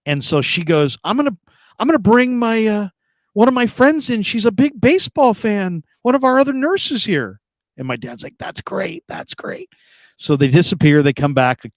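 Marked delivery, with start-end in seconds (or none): 1.0-7.4 imitating a nurse in a high, eager voice; chirpy nurse. Nurse offers to bring baseball fan friend, chirpy nurse. 8.4-9.7 imitating a whispery, feeble patient; barely able to talk. Sick dad answers the nurse, barely able to talk. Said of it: "he can barely talk".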